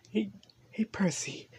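soft unvoiced whisper; whispering to Percy. Real, not acted. Waking Percy gently, soft whisper. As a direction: coax him gently under your breath.

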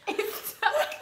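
teasing echo; laughing echo. Real, not acted. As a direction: echo her, giggling. Echoing partner.

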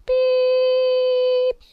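high, held beep like a train whistle; mock whistle. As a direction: squeak out a long whistle beep. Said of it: "I don't know how to make whistle sound".

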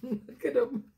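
ordinary delivery, nothing marked

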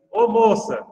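loud, high call across the pub; a retold call to get her attention. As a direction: call the waitress over. Calling the waitress in a pub.

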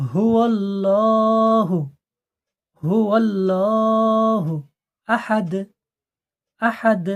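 slow drawn tajweed chant; Quran recitation. Shown as a demonstration. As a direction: recite slowly, stretching হুয়াল্লাহু. Recitation demo. (ধীর সুরে তিলাওয়াত)

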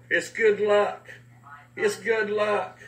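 high, slow, tender repeat; mother soothing a little boy. Retold or imitated. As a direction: press the necklace into his hand gently. Mother giving necklace to boy.

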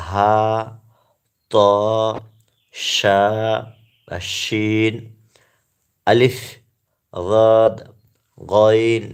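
loud, measured drill of each letter with pauses; reciting Arabic letters. Shown as a demonstration. Teacher calling out the Arabic alphabet for viewers, loud and deliberate. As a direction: call out each letter clearly for learners to repeat. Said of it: "চলুন আমরা এটি পাঠ করি".

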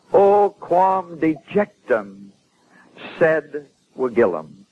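very slow, stretched Latin; recited Latin lament. Retold or imitated. Preacher quoting a man's heart-searching, slow Latin declamation. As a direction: declaim each Latin word separately, with long vowels. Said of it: "he listened to him a little further out of his heart".

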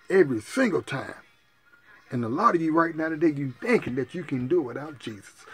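ordinary talk

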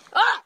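sudden high yelp; startled yelp. Real, not acted. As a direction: jump and yelp. Startled by bug.

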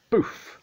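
short, loud puffed "boof"; imitated thud. A narrator's reading. Imitates cushion thrown.

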